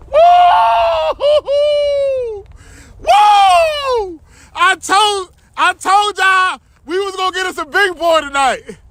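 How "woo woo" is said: high loud whoop; excited whoop. Real, not acted. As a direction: whoop it out in triumph. Big catfish landed.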